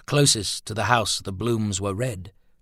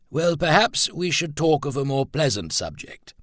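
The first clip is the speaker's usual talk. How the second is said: firm suggestion; 7 semitones higher, 5 dB louder than usual. Acted. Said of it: "tone was not that of mere suggestion".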